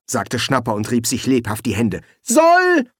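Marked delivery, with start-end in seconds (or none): 2.2-2.9 high, loud summoning shout; sharp, high call. Acted. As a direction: yell the name across the room. Boss summons his nephew.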